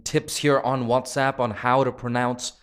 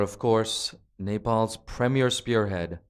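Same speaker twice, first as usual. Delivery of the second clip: ordinary talk, nothing marked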